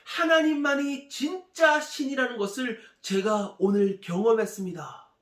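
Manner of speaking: loud, high-pitched jubilant proclamation; crying out. Acted. Healed general running to the prophet, crying out. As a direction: proclaim it at the top of your voice. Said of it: "이렇게 외칩니다".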